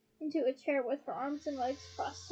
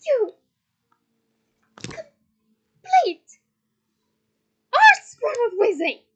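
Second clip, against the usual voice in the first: high, loud, one word per blow; furious, punctuated outburst; 8 semitones higher, 15 dB louder, much slower than usual. A narrator's reading. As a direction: punch out every word in a rage. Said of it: "She punctuated every word with a blow".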